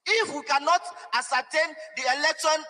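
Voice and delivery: usual speaking voice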